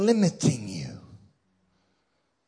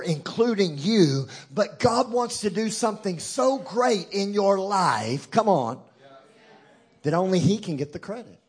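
preaching; 3 semitones higher, 5 dB louder than usual. Acted. Pastor urging the congregation, preaching at full force. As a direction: build it like a revival preacher.

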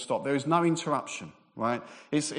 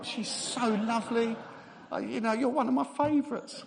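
playful; 8 semitones higher than usual. Real, not acted. Teasing reply to a heckler.

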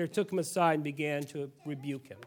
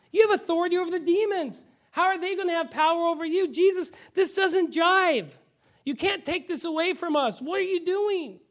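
much higher, insistent, upset protest; Peter rebuking Jesus; 9 semitones higher, 3 dB louder than usual. Acted. Peter pulling Jesus aside to rebuke him, high upset protest. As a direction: scold him as if he is throwing away your hope. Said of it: "starts to rebuke him".

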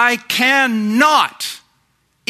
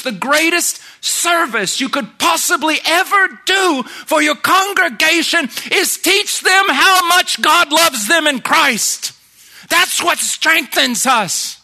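preaching at full force; 8 semitones higher than usual. Real, not acted. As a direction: proclaim it to the back row. Preaching climax on God's love.

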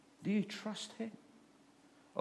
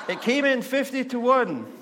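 much higher and louder, gleeful; excited punchline; 13 semitones higher, 12 dB louder than usual. Retold or imitated. Man boasts to the nun that the horse won, high and loud. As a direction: deliver the payoff with glee.

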